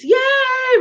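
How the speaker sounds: high-pitched crowd yay; one long high cheer; renewed cheering. A narrator's reading. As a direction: cheer like an audience. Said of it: "renewed cheering".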